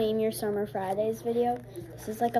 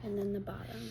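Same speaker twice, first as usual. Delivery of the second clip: whisper; 8 dB quieter, faster than usual. Real, not acted.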